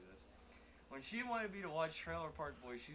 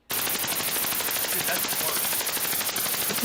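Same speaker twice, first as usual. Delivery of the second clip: distorted robot mic; garbled broken microphone; robotic glitching mic; 12 dB louder, much slower than usual. Real, not acted. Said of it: "You literally sound like a robot having an aneurysm".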